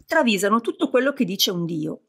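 usual speaking voice

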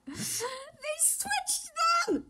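high, stretched squeal, half crying; squealing fangirl noise. Real, not acted. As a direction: fangirl shriek of delight.

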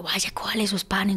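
hushed whisper, no voice; whispered urging. Retold or imitated. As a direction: whisper it so the old woman won't hear. Retells friend urging theft. (susurro para que no los oiga)

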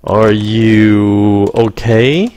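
slow, caring rising question; concerned question. Shown as a demonstration. Teacher models comforting a sick friend, slow and caring. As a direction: ask gently, really caring. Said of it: "Cái thứ ba nữa".

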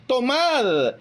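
slow, deliberate reading of the Gospel; solemn scripture reading. Acted. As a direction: draw out each word of Christ. Reads the Gospel words solemnly. (lectura lenta y solemne del evangelio)

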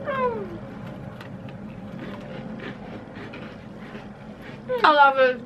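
chewing while talking; covering mouth mid-chew; mouth full. Real, not acted. Seen 0: Talks with a hand over her mouth right after biting the biscuit.

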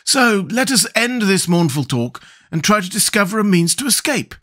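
high, resolute character voice; high-pitched call to action; decisive. A narrator's reading. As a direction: urge them on as a firm Scarecrow. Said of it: "said the Scarecrow with decision".

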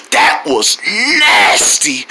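low, drawn-out play disgust; mock disgust. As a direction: make a show of being grossed out. Acted disgust at shock pain.

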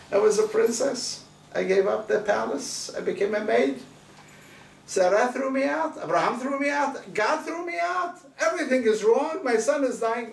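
high, rising list of grievances; a wronged princess venting her despair; anguished complaint. Acted. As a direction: pour out every wrong, climbing in pitch. Hagar lists her woes.

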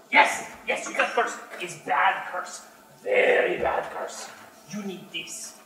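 broken-English goblin conning a stranger; goblin merchant. Acted. Goblin tells the visitor he is cursed, wheedling. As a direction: sell the curse, then hush it down. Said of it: "I play goblin".

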